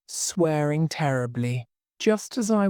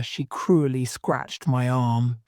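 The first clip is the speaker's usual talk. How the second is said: plain reading voice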